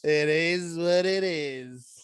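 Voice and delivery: slow, drawn-out shrug of a line; slow deadpan. Acted. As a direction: stretch each word like a motto. Echoes friend, deliberate drawl.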